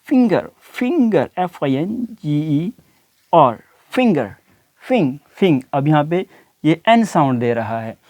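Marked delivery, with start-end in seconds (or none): 0.1-5.2 फिंगर drawn out slowly with a light ng; light ng sound. Shown as a demonstration. Teacher drilling students: slow फिंगर with a light ng. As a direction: spell and stretch फिंगर, ng kept light. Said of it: "light sound है".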